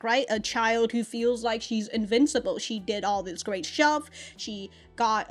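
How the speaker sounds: ordinary talk, nothing marked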